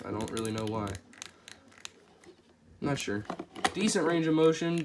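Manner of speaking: usual speaking voice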